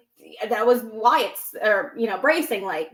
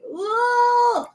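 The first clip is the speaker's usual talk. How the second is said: high, drawn-out creeped-out ooh; a shivery, mock-spooked ooh; 12 semitones higher, 4 dB louder than usual. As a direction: let the creepiness give you a thrill. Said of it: "And I'm just like".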